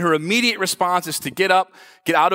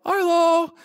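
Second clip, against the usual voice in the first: calling out; 11 semitones higher than usual. Retold or imitated. Father calling lost son in store.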